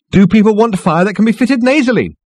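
very high, loud, strident voice; insistent. A narrator's reading. Marketing girl takes up Ford's jibe, insistent. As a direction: insist on every word. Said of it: "insisted the girl".

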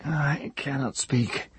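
high, slow, strained voice; a wrecked man struggling to speak; broken old man. A narrator's reading. Hare's voice.

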